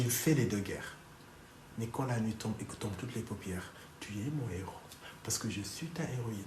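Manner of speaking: low, soft pillow talk; seductive. Acted. Wife tells husband he is her hero, low seductive tone.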